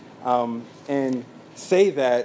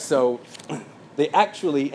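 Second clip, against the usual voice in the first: ordinary delivery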